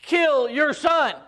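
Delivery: high, loud, drawn-out warning; slow it down and call it out high; a heavenly stop, loud and stretched; urgent angel. Retold or imitated. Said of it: "And he's like".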